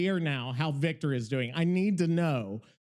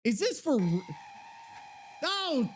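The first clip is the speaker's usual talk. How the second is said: much higher, aghast swearing; shocked disbelief; 9 semitones higher than usual. Real, not acted. Host reacting to the awful AI song, pitched-up shocked swearing. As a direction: recoil in real horror at the noise.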